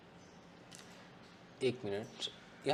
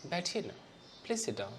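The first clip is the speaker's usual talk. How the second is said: soft, gentle loving invitation; lovingly; 2 semitones higher, 8 dB quieter than usual. Shown as a demonstration. Teacher shows a loving request, soft and tender. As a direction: coax them kindly to sit. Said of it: "उसने बहुत प्यार से मुझसे कहा".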